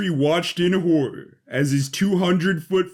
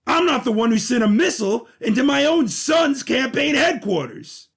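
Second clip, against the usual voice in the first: angry retort; 10 semitones higher than usual. Acted. Patri answers Jane E's accusation, high indignant outburst. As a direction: fling the blame right back at her.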